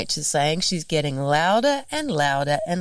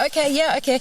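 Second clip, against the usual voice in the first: high amused okay; 10 semitones higher, faster than usual. Retold or imitated. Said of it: "And I'm like".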